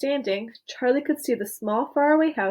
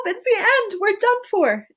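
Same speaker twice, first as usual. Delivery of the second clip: high, loud terrified cry; panicked shout; 10 semitones higher, 4 dB louder than usual. A narrator's reading. Grandpa Joe crying out as they crash through the roof. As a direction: cry out in doom. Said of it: "Grandpa Joe shouted".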